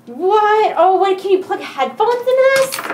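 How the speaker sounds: high, sudden delighted disbelief; surprised. Real, not acted. Discovering headphone ports on his keyboard, amazed. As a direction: react as if you can't believe it.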